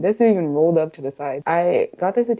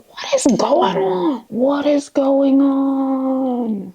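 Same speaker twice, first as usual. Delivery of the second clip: much higher, slow mock-panicked whisper; confused teen; 8 semitones higher, much slower than usual. Vlogger imitating her younger self seeing acne, high slow mock panic. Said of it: "you're like".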